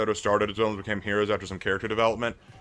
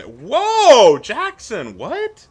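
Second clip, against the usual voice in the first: sudden high startled whoa; real surprised disbelief; 13 semitones higher, 12 dB louder, much slower than usual. Real, not acted. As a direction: caught off guard, pitch jumps up. Reacting to chat message.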